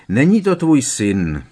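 plain reading voice

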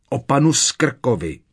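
loud frantic shout; bursting out shouting. Acted. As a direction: blurt it at full voice. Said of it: "rozkřikl se Harry".

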